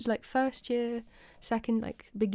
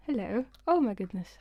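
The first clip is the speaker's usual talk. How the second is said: normal talking voice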